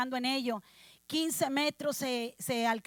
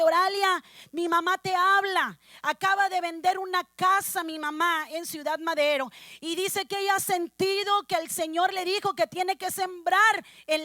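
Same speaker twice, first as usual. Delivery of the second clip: raised, emphatic testimony to the congregation; fervent preaching; 4 semitones higher, 4 dB louder than usual. Real, not acted. Preacher telling the church of answered prayer, fervent preaching. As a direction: preach the story with urgent fervour.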